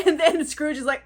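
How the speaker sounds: ordinary delivery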